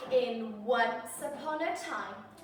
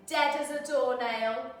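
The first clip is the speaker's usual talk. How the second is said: usual speaking voice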